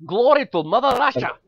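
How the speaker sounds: put-on loud, high Russian-accent rallying cry. Host shows off his Russian accent to Nick, loud and hammy. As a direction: shout it like a proud Russian patriot. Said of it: "because I can do the accent".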